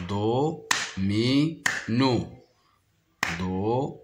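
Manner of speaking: three even, separated beats in "Domino": do, mi, no; clapped-out syllables. Shown as a demonstration. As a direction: say "Domino" one syllable at a time. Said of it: "Je frappe les syllabes".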